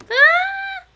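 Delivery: very high, loud cartoon shriek; sustained high-pitched scream; panicked scream. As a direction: yelp in comic alarm at a mishap. Mishap on screen while drawing.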